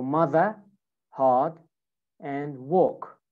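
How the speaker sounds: British "mother, hard, and walk" without R sounds; non-rhotic British "mother, hard, and walk"; British accent. Shown as a demonstration. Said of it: "don't use the R".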